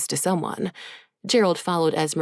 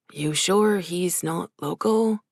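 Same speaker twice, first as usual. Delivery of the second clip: ordinary delivery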